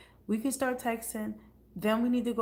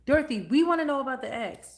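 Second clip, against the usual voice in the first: louder demand; 4 semitones higher, 8 dB louder than usual. Real, not acted. Demanding the viewer's gossip.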